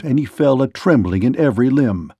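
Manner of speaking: ordinary delivery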